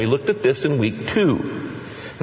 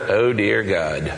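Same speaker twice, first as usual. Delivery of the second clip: timid prayer; 5 semitones lower, 4 dB quieter than usual. Pretend. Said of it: "He hates the kind of prayer".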